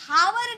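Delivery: "हावर" with the h pronounced; wrong sounded H. Shown as a demonstration. Teacher showing students what is not said, "हावर" h-first. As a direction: breathe an audible h onto "हावर". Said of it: "हावर नहीं बोलते".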